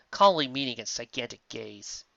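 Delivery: ordinary delivery, nothing marked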